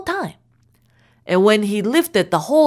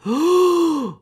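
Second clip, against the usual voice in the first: shocked gasp; 6 semitones higher than usual. A narrator's reading. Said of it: "his turn to go".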